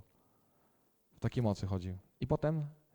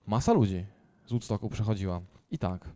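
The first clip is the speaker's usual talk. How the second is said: ordinary talk, nothing marked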